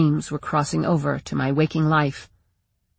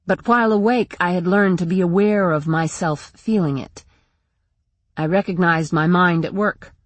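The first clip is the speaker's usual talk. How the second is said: usual speaking voice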